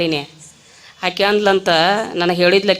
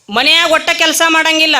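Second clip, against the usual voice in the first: ordinary talk, nothing marked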